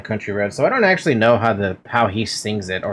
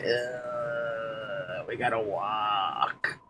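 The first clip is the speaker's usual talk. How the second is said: much softer, slow defeated groan; weary groan; 3 semitones lower, 13 dB quieter, much slower than usual. Acted. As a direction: sigh it out, drained. Groaning at walking.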